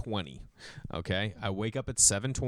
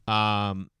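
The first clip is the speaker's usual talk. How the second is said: ordinary talk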